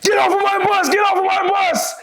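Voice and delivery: very high screamed command; full-throated, high-pitched bellow; drill instructor yelling. Retold or imitated. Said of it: "he started yelling and screaming".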